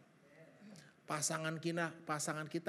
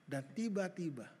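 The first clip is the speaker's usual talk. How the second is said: ordinary talk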